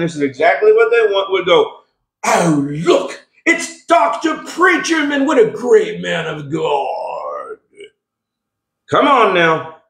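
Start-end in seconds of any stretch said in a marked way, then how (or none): 2.2-7.6 hammed-up British admiration for a preacher; mock British accent. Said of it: "I did a British accent".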